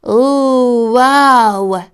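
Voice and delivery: gasped. Acted. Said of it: "he gasped".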